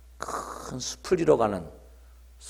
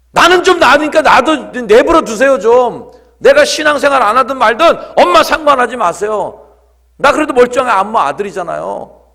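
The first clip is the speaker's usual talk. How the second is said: loud, high-pitched acted teenage tantrum; irritated outburst; 12 semitones higher, 16 dB louder than usual. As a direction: yell at mom to leave you alone. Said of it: "아이가 진짜 신경질을 부리더래요". (신경질 내며 고함치는 아들)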